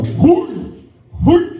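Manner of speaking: short high barked "hoi"; imitated hoi shouts. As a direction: throw out each cry high and abrupt. Imitated shouts while throwing people out.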